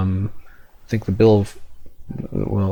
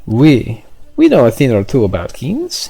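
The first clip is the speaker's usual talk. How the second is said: put-on French accent; 3 semitones higher than usual. French official answering in accent.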